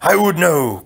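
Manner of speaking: loud, indignant cry; cried out. Acted. Prince cutting back at his mother, indignant cry. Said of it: "cried the prince".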